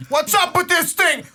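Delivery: high goofy confused voice; mock dumb voice. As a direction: play a clueless buddy. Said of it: "Jesse be like".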